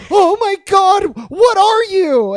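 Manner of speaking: loud, high, drawn-out disbelief; stunned villain impression; mock astonishment. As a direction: cry out in hammed-up shock. Acts out stunned villain's reaction.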